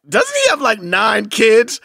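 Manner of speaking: high, slow drawled question; mock woman's voice. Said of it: "she was like".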